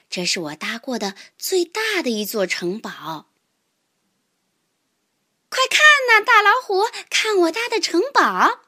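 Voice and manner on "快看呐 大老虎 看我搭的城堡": high, loud excited call. Acted. Mouse showing tiger the castle, excited and proud. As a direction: show it off, pitched right up.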